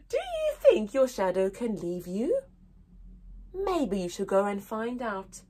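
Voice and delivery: usual speaking voice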